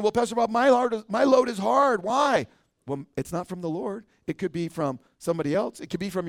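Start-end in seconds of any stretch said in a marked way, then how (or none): 0.0-2.5 complaining congregant. Acted. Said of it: "And you're saying".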